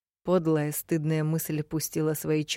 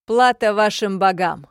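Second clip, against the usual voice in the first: barbarian proclamation; 4 semitones higher, 9 dB louder than usual. A narrator's reading. Barbarian addressing crowd.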